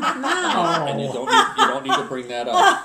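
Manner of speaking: high, loud laughing protest. Real, not acted. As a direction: laugh out the objection. Laughing at drunk remark.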